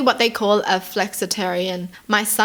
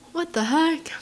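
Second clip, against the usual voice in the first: whispered what the heck, pitched higher; shocked whisper; 6 semitones higher, 4 dB quieter than usual. Real, not acted. Reacting to the husband's story with a shocked whisper. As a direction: gasp it out in disbelief.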